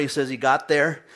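normal talking voice

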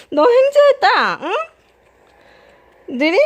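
talking to a dog. Real, not acted. Teasing the dog happily.

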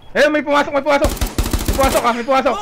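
high, loud alarmed callout; excited callout. Real, not acted. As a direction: shout the alert, urgent. Spotting enemy entering, calling out.

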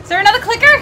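normal talking voice

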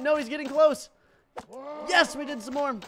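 high, loud alarm turning to cheer; panicky excitement. Real, not acted. Gamer as the opponent closes in, excited yelling. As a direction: yell through the rush.

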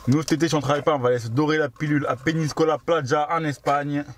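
ordinary delivery

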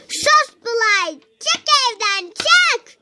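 high, loud play-fight shout; a toy girl shouting at Burak to get out; angry doll yell. Acted. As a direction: throw him out of the house in a shrill doll voice. Doll quarrel, kicking Burak out.

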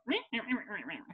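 put-on a childish parody of a complainer; mock nagging. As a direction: mimic her nagging as nonsense. Mocking the nagging woman.

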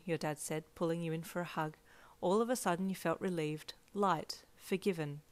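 plain reading voice

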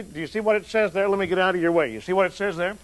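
ordinary talk, nothing marked

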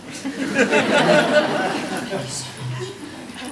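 high, bright burst of laughter; real laugh. Real, not acted. Audience reacts to Tokoroa.

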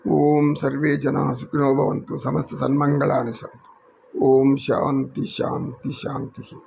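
slow, measured recitation of a peace invocation; closing Sanskrit prayer. Real, not acted. (నెమ్మదిగా శాంతి మంత్ర పఠనం)

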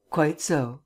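ordinary delivery

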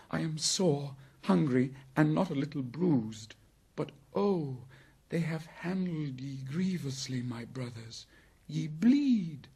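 put-on high-pitched child's lament; distressed boy. A narrator's reading. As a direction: grieve for wounded brothers in a high voice. Rescued boy sees friends bleeding.

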